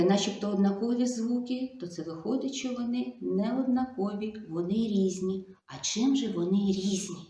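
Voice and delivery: usual speaking voice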